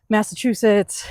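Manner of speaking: ordinary talk, nothing marked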